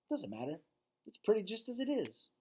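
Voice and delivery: put-on a kind little girl reassuring; lightly voiced child; young girl. Julia speaks to Ivan.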